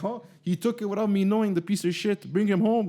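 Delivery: mock angry uncle. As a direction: play up the fuming uncle a little. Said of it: "his uncle's like".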